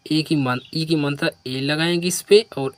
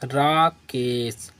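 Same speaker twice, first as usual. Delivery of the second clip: slow reading; 2 semitones lower, much slower than usual. Shown as a demonstration. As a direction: draw the name out slowly. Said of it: "क्या पढ़ेंगे?".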